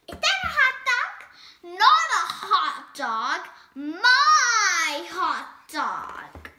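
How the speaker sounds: possessive pigeon. Acted. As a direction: claim it like a greedy bird. Pigeon guards hot dog.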